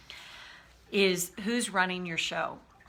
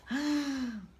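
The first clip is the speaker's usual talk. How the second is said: high, startled intake of breath; wounded child gasp; 7 semitones higher than usual. Acted. Coach acting out the wounded child, frightened gasp. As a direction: gasp like a frightened little kid. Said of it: "the way I see the wounded child is".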